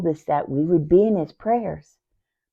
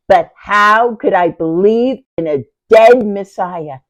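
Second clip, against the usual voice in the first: raised voice, pleading disbelief; anguished question; 3 semitones higher, 8 dB louder than usual. Acted. Sister doubts a dead Messiah, anguished. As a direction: cry out the doubt loudly.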